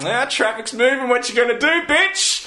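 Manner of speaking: high-pitched mocking taunt, retold; cocky taunt. Pedestrian goading an angry man stuck in moving traffic, cocky mock taunt. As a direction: taunt him like you're safely out of reach.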